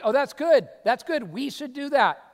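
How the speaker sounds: bright, hammed-up approving voice; playing the enthusiastic listener; mock eager listener. Congregation voiced agreeing.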